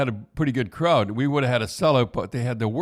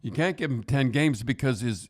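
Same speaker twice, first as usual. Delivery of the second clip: usual speaking voice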